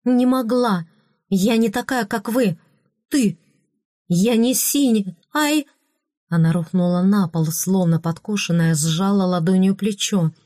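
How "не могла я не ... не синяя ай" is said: high, urgent outcry; cry out. A narrator's reading. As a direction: burst out, voice pitched up. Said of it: "воскликнула она".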